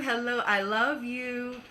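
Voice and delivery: usual speaking voice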